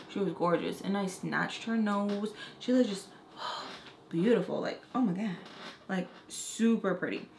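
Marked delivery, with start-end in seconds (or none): none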